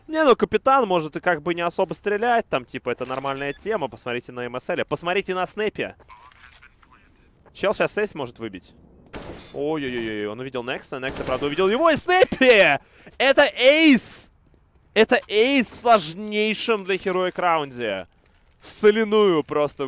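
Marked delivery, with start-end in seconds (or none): 0.1-2.5 higher, louder hyped explaining; excited. Real, not acted. Excited casting. 11.5-19.9 loud, high, drawn-out ace call; hyped caster shout. Real, not acted.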